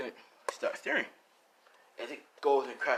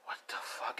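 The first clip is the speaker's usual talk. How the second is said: hushed, baffled disbelief; acted speechless bewilderment; stunned whisper; 9 dB quieter than usual. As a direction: whisper it under your breath in shock. Stunned hero reacts to lick.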